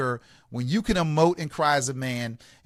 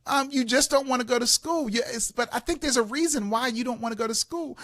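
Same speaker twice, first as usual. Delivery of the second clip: patient uncle; 7 semitones higher than usual. Retold or imitated. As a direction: gently reflect it back to the child. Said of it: "I said".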